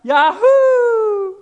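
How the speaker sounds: high, loud, giddy cheering; mock cheering crowd. Preacher mocking people who think Hosanna is a cheer, giddy yelling. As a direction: ham up a cheering crowd waving at a celebrity. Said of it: "That's not, that's not this word at all".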